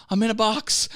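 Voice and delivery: high, put-on mime voice; silly high voice between laughs; talking mime. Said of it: "are you talking like this".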